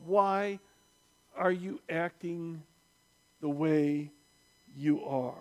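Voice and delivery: quietly probing, broken by long pauses; slow, weighty question. Real, not acted. Slow reflective repeat of shouted question.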